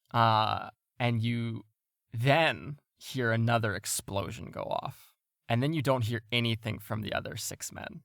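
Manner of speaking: usual speaking voice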